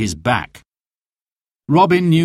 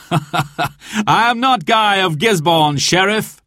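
higher, slow, laughing reveal; 8 semitones higher than usual. Acted. Robin laughs at the sheriff as he unmasks, high and slow. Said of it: "he laughed".

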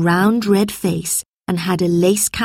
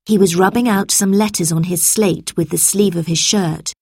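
ordinary delivery

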